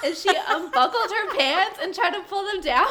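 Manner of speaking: high, loud, helpless with laughter; laughing storytelling. Real, not acted. Vet telling coworker about owner undressing, loud laughing disbelief. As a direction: tell it incredulously through real laughter.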